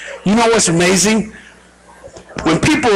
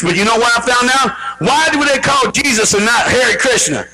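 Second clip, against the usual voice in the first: normal talking voice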